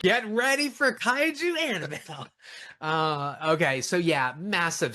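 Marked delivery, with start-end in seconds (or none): 0.0-2.3 laughing announcer. Acted. Hyping a joke movie, laughing.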